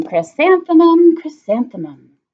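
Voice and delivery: joyful savouring. Acted. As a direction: savour her name happily. The name savoured happily.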